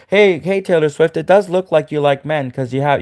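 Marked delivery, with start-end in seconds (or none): none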